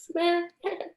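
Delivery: very high squeaky dolphin squeal; chirpy clicking dolphin. Pretend. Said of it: "the worst dolphin impression ever".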